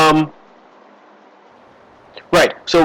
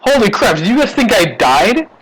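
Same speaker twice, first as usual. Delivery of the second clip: higher, bewildered outcry of the man waking at his wake; hammed-up astonished Finnegan; revived drunk's surprise; 6 semitones higher than usual. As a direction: play the corpse sitting up, amazed. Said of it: "He's like".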